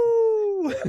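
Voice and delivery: high, loud, playful whoop; mock thrill-ride holler; roller-coaster whoop.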